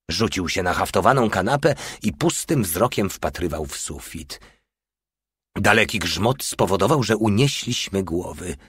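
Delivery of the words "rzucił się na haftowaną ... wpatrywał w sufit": ordinary delivery, nothing marked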